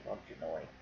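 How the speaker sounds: low, hushed Michigan twang; quick mock northern Michigan aside; Michigan accent. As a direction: toss in a soft, low-pitched Michigan accent. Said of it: "I can say that 'cause I'm from Michigan".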